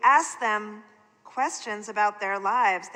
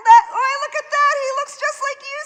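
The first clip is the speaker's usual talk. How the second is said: much higher, loud, fast laughing exclamation; laughing aunt; 10 dB louder, faster than usual. Acted. Aunt laughing at the boy copying Sam, loud and high. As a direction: squeal it through laughter.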